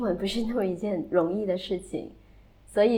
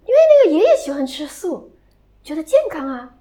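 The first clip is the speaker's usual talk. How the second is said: quoting the student; 6 semitones higher, 9 dB louder than usual. Retold or imitated. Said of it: "说".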